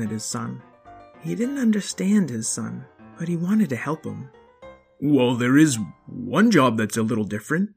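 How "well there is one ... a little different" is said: dad voice. Acted. Father voice.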